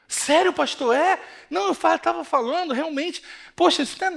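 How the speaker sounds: put-on high, surprised young woman; surprised girl voice. Girl questioning the pastor's advice, high and surprised. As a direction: play the girl, caught off guard and unsure.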